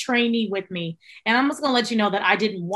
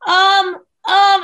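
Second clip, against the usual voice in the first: mock flustered um, higher and louder; panicked stammer; 8 semitones higher, 7 dB louder than usual. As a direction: play the trainee caught off guard. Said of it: "she's panicking the entire time".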